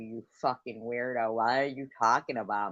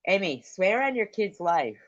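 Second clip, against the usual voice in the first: raised, heated challenge; angry demand; 7 semitones higher, 5 dB louder than usual. Real, not acted. Streamer angrily demands her rival swear on her child.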